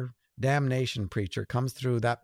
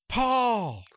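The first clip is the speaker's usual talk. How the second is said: high, louder happy call of a name; 11 semitones higher, 3 dB louder than usual. Retold or imitated. Passenger spotting the author on the airport train, calling out gladly. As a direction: hail an old friend across the train.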